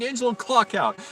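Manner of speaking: high-pitched exasperated order; frustrated manager. Retold or imitated. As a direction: imitate a fed-up boss dismissing him. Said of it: "manager was getting so frustrated".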